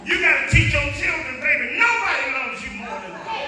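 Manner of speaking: loud, rising sermon cadence; preaching. Acted. Preacher to congregation, full-force preaching.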